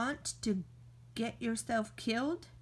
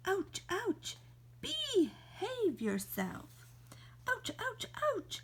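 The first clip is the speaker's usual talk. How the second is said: high yelps of pain, getting higher; pained ouches; 8 semitones higher, 3 dB quieter than usual. Acted. Stung by bees, yelping high in pain. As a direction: squeal each ouch as the stings land.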